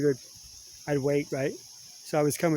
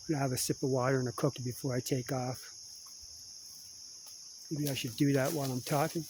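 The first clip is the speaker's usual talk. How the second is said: ordinary talk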